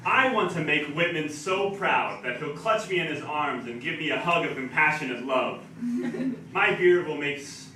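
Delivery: loud, punchy spoken-word declaiming; mock-grand poem delivery; comic poetry recital. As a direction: proclaim it boldly for laughs.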